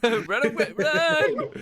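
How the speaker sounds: laughing, shouting run away. Pretend. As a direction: yell it through laughter. GM laughing mock panic.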